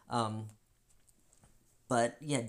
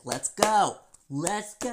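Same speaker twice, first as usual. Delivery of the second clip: higher and much louder call to fight; ready to fight; 9 semitones higher, 9 dB louder, slower than usual. Retold or imitated. About to explode at the friend, loud. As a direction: bark it like squaring up. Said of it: "I was about to be like".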